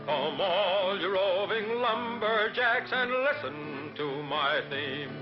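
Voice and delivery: ordinary delivery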